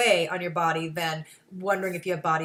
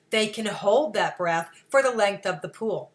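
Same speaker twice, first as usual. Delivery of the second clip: ordinary talk, nothing marked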